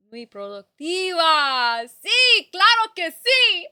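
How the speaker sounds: high, loud, slow mock Spanish flourish; hammed-up Spanish. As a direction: show off your Spanish with gusto. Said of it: "I can Spanish so good".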